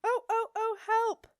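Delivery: very high, loud alarm wail; shrieked. A narrator's reading. Alarmed lock calls for help as witches come, shrieking. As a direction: shriek repeatedly like a siren. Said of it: "shrieked the".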